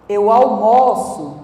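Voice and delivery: "almoço" as verb, with open stressed O; slow example, open O in "almoço". Shown as a demonstration. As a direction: say "almoço" with an open "aw-MAW-so" vowel. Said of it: "Almoço é diferente de almoço".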